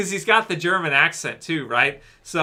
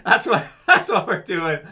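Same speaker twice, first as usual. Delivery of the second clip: laughing. Real, not acted. Laughs while talking to viewers.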